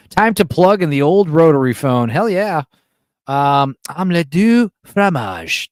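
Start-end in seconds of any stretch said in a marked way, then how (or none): 3.9-5.7 slow, drawn-out mock French cartoon line; Dexter's Lab quote. Streamer quoting Dexter's Laboratory to chat, slow mock French. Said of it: "Dexter's Laboratory, of course".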